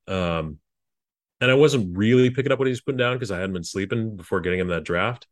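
normal talking voice

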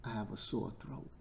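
quiet sore-throat aside; hushed aside. Pretend. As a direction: drop it right down after the shouting. Drops voice after loud shouting.

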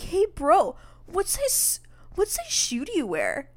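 mock bro-talk, high and punchy; imitated kid. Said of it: "What did that one kid say?".

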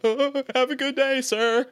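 high-pitched, deferential mock farewell; mock-timid politeness. Would-be fighter when the situation happens, high mock-timid farewell. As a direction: imitate yourself meekly backing down. Acts out backing down when confronted.

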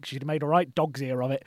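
ordinary talk

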